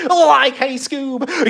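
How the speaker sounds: high, loud cartoon stoner squeal; big high-pitched Scooby-Doo cartoon voice; cartoon Scooby-Doo sidekick. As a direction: squeal it out to your dog pal Scoob. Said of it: "Can you do the Scooby-Doo voice".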